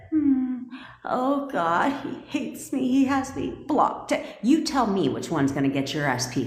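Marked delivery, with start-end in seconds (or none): 0.1-4.1 a mocking impression of a heartbroken sulker; mopey self-pity. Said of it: "Instead of".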